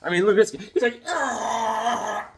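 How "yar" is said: high, drawn-out raspy cry; Thanos roar. Retold or imitated. Host voicing a comic panel, long high roar. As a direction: let out a long mock roar.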